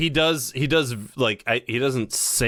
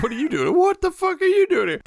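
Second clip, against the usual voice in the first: much higher, louder outraged yell; mock shout; 4 dB louder than usual. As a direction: shriek it in fake outrage. Echoing the startled quote.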